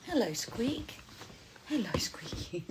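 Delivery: soft whispered greeting. Real, not acted. Whispering to baby.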